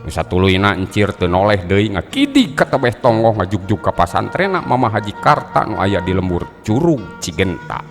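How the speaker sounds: ordinary delivery, nothing marked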